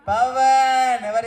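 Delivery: slow, high, loud call into the crowd. Real, not acted. Host asking the crowd who said it, loud slow call. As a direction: stretch it out across the hall.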